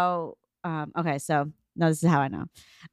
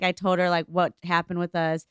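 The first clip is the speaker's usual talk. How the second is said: ordinary delivery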